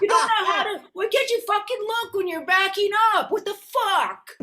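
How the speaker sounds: high, loud, furious ranting; imitated angry yell. Imagined partner screaming about the dented car, furious. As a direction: rant like a fed-up partner.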